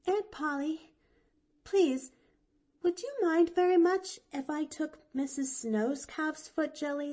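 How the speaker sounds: put-on high, eager little girl; young girl voice. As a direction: chirp it out like a bright chatty child. Pollyanna asks her aunt.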